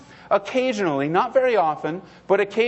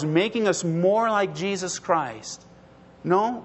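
ordinary talk, nothing marked

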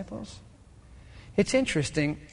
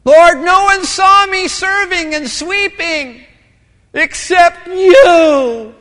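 slow, loud, higher wailing prayer with a laugh; mock lament; 11 semitones higher, 12 dB louder, much slower than usual. Preacher mocking his own complaint to God, slow wailing cry. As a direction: wail to the Lord like a hammed-up martyr.

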